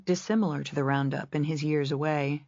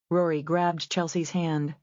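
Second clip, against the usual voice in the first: ordinary delivery, nothing marked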